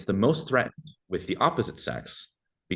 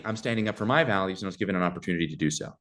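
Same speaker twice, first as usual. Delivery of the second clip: ordinary delivery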